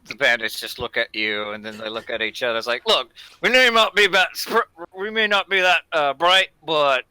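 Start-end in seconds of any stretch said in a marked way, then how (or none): 2.9-7.1 loud bandit voice. Acted. Bandit replies.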